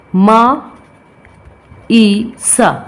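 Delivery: ma ... e, sa as separate phonics sounds; sounded out. Shown as a demonstration. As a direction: break the syllable into ma, e, sa with gaps. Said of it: "three vowel sound". (ध्वनियाँ तोड़कर उच्चारण)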